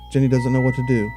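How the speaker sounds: usual speaking voice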